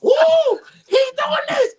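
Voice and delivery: high, loud whoop of delight; kid-like excitement. Retold or imitated. Comic retelling his reaction to the DJ bit, giddy excitement. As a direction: squeal it like a kid in a candy store. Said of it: "I was like a little kid in the candy store".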